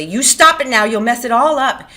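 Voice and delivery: high, very loud scolding; angry cry. A narrator's reading. Curley's wife to Lenny, angry shout. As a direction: cry out to make him stop. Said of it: "And she cried angrily".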